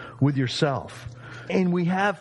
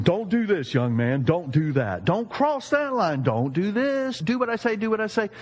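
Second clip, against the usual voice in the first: nagging rule-giver, pitched higher; mock scolding; 6 semitones higher than usual. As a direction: rattle off orders like a scolding elder. Voicing rule-giver's list of don'ts.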